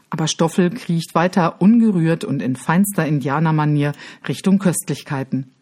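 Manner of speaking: plain reading voice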